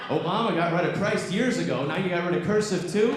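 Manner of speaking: high-pitched mock outrage; hammed-up indignant complaint; outraged dad. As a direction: imitate a father in indignant protest. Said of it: "and he was upset. He was mad".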